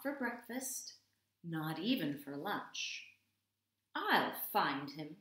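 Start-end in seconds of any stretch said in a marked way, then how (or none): 3.9-5.2 a light scoff, a bit louder; scoffing cat. Acted. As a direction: toss it off like a smug cat. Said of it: "scoffed the cat".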